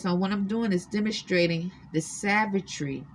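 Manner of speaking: ordinary talk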